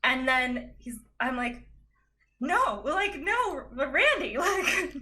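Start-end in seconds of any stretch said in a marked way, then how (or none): 2.4-4.9 high, pleading retold "no"; horrified protest. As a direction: plead in mock horror, pitched up. Horrified protest to Randy in story.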